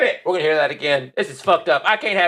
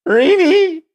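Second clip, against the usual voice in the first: much higher, louder squeaky crack; mock cracking high "Rini?"; imitating a voice crack; 11 semitones higher, 6 dB louder than usual. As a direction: squeak it like his voice breaking. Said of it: "he's getting real high pitch again".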